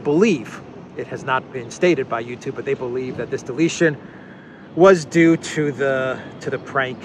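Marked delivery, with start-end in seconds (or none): none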